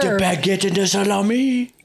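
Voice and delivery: jokey fake French accent; lightly put-on French accent; mock French. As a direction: ham up a French voice for a laugh. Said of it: "That doesn't sound like French".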